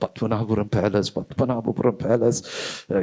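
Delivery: acted tongue-tied student, unsure; baffled student. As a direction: mumble the place name over, searching for words. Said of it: "இவன் மேல கீழே பார்ப்பான்".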